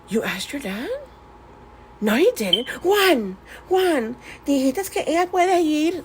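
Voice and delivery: shocked mom, rising high on Juan; indignant mom. Retold or imitated. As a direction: accuse the husband in disbelief. Mom confronting dad in Spanish.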